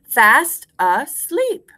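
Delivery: very slow, full voice; slow rhyme recitation. Shown as a demonstration. Teacher gives class a line to repeat, slow and clear. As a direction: space out each syllable, rising at the end.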